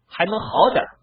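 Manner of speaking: plain reading voice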